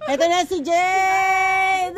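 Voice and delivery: slow, loud, high announcement; calling out. Real, not acted. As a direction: call out her name across the yard. Announcing friend's arrival loudly.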